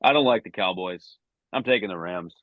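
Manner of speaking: usual speaking voice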